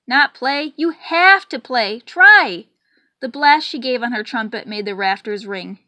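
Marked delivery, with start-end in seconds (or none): 0.1-2.7 building from urgent to a high, loud cry; insistent shout. A narrator's reading. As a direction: push harder on every word. Trumpeter insists, rising to shout.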